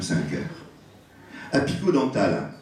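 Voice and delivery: ordinary talk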